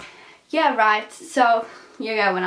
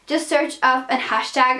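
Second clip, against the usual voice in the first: ordinary delivery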